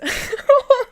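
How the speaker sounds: high teary laugh that tips into crying; laughing into tears. Real, not acted. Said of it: "I'm starting to cry right now".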